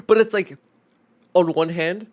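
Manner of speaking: exasperated outburst. Real, not acted.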